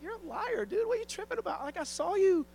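high mocking little girl, half-hearted teen slang; sassy little girl. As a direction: call him a liar like a cheeky kid. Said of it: "she's like".

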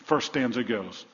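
ordinary delivery

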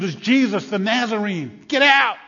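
loud, high shout peaking at the end; screamed warning. Acted. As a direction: scream it across a panicked crowd. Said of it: "Somebody screams".

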